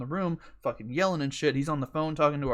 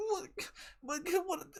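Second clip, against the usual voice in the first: tiny, high, softer whimpers; mock crying kids; 14 semitones higher, 8 dB quieter than usual. Kids beside mad dad and depressed mom, mock crying. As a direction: imitate kids blubbering in short bursts. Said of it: "they're all fucking crying".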